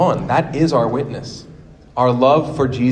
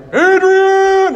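high, drawn-out movie yell; mock full-throated cry; calling out "Adrian"; 5 dB louder than usual. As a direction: holler it like Rocky, long and loud. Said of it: "I was like".

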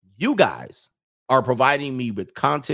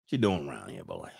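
Mississippi accent; 12 semitones lower, 8 dB quieter than usual. Shown as a demonstration. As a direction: ease it out low and soft, Mississippi style. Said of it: "do the Mississippi accent".

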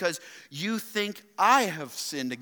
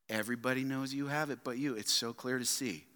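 hushed punchline; 6 semitones lower, 9 dB quieter than usual. Shown as a demonstration. Punchline dropped to a hush.

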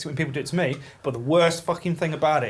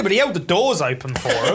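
normal talking voice

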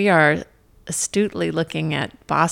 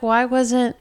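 ordinary delivery

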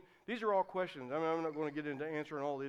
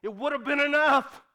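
raised, emphatic preacher's declaration; with conviction; 8 semitones higher, 11 dB louder than usual. Shown as a demonstration. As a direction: proclaim it firmly, pitch lifted. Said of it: "just say it with conviction".

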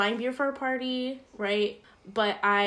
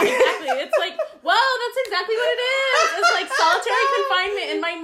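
high, loud, laughing through the words; 11 semitones higher, 6 dB louder than usual. Real, not acted. Joking with her friend about her mind, laughing. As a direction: crack up while you joke.

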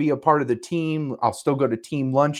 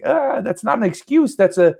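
indignant protest; 7 semitones higher than usual. Retold or imitated. Mocking reply to her.

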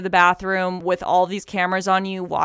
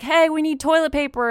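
high-pitched calling out; mock yell; 9 semitones higher than usual. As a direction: imitate yelling for help, without full volume. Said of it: "We were, like, yelling".

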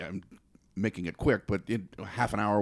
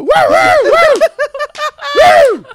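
very high, loud whoops; whooping cheer; 15 dB louder than usual. Retold or imitated. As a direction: celebrate like rowdy hunters. Hunters cheering, retold.

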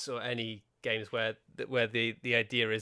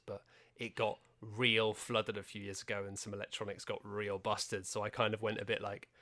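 normal talking voice

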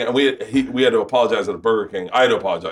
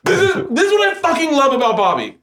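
much higher, laughing through the words; can barely get it out for laughing; giggling high-pitched protest. Real, not acted. Laughing while starting the story.

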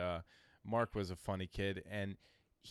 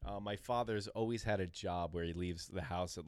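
ordinary talk

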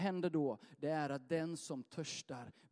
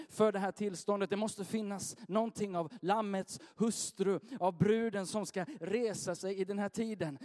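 high, loud, urgent sermon delivery; full-force preaching; 6 semitones higher, 6 dB louder than usual. Acted. Pastor rousing the congregation to seek more, full force. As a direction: drive it home to the congregation.

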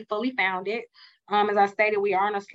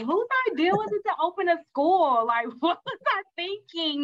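high, laughing mock exasperation; joking self-doubt; 8 semitones higher than usual. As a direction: ask yourself with a laugh in disbelief. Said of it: "Sometimes I joke and I ask myself".

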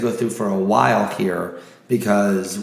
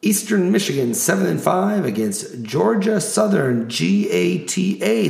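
announcer voice; 5 semitones higher than usual. Acted. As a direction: hype each matchup like a ring announcer. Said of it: "my announcer voice".

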